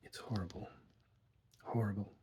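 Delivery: doctor murmuring, shaken, to himself; murmured. A narrator's reading. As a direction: say it almost to himself. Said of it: "I heard him murmur".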